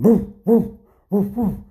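loud, high imitated woofs; dog barking. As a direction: bark loudly like a dog. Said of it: "He's barking".

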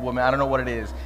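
ordinary talk

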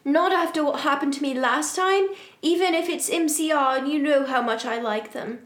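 put-on understated teenage outrage; angry shout. A narrator's reading. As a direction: read with a touch of protest. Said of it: "I shouted angrily".

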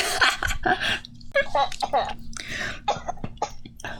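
airy giggling fit; breathless laugh. Real, not acted. Laughing at own joke.

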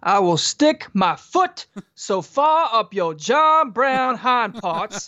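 high, slow, drawn-out coach tirade; hammed-up movie coach voice; tough coach. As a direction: threaten it slowly like a crazed coach. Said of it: "Coach, there's a fine line between tough and crazy".

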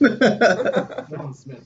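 loud booming John Smith; mock announcement. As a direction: belt out the name like a big reveal. Mocking a plain pet name.

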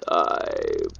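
very low, soft rasp of a word; a deep ghostly voice through phone static. A narrator's reading. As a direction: let it crawl out, deep and ghostly. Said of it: "crawled out of the static".

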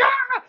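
high, loud startled scream; shocked scream. Retold or imitated. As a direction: shriek in alarm.

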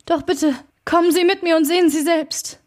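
a scared butler pleading to come; fearful urging. A narrator's reading. Frightened servant urges lawyer.

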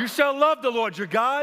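high, loud proclaiming shout; shouted call to the congregation; with chutzpah. Real, not acted. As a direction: call it out with guts to the crowd. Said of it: "with some guts".

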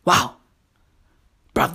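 ordinary delivery

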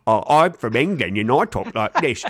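loose British actor impression; Michael Caine. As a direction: protest in an imitated British accent, slipping as it goes. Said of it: "That was less Michael Caine".

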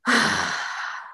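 long, voiceless exhale; weary sigh of a fed-up captor; exasperated sigh. Acted. Blue guy exasperated after face slap.

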